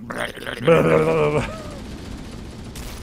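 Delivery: loud laugh. Real, not acted. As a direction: burst of laughter. Laughing at the game.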